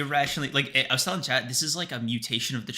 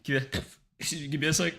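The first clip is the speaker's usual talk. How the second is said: breathless after laughing; out of breath; 3 semitones higher, faster than usual. Real, not acted. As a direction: gasp the words out between breaths. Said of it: "trying to catch my breath".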